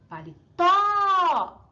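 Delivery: paletó slow, with TÓ long, high and loud; stronger stressed syllable. Shown as a demonstration. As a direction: hold the final TÓ of "paletó" at full volume. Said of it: "o acento é a mais forte".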